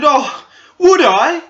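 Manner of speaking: put-on high, slow, thrilled squeal of a girl; hammed-up girl gushing at being asked; excited girl. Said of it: "she was so excited".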